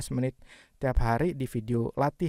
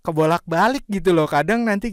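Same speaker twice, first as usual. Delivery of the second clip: laughing; 5 semitones higher, 9 dB louder than usual. Real, not acted. Laughing at own mix-up.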